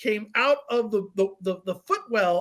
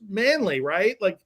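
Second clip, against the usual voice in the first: ordinary talk, nothing marked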